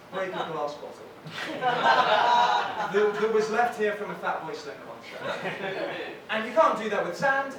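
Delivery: ordinary talk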